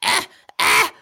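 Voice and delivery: high, breathless panicked squeaks; panicked gasps. Acted. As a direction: gasp out in sudden panic.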